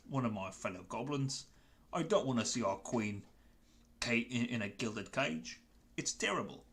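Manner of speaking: Cockney goblin like Michael Caine; Marjode. Acted. Goblin thanking fellas for helping, pleading Cockney voice. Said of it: "I'm gonna do a quick character voice".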